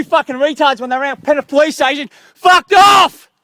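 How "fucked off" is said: yelled hard and high at full volume; real angry shout. Real, not acted. Man ranting at police, yelling in fury.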